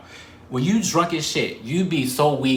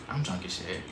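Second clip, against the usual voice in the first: hushed confession; 6 semitones lower, 12 dB quieter than usual. Retold or imitated. Confiding to classmate.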